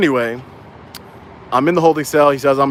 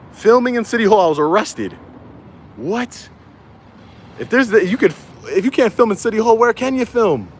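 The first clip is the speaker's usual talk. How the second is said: incredulous indignation; 8 semitones higher than usual. Real, not acted. Indignant about arrest for filming.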